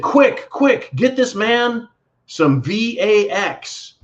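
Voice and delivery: high, frantic mock shout of pharmacy staff; hammed-up alarmed staff voice, quick then slow; mock panic. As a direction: squeal it like a panicked clerk.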